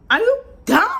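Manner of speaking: high, loud retold yell at her boyfriend; angry yell. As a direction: snap it at him, furious. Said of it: "I was so mad".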